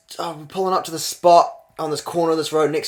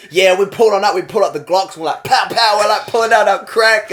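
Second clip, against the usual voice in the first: loud, raised-pitch imitation of a braggart; boasting crackhead; 7 semitones higher, 7 dB louder than usual. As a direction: act out a wired dealer bragging.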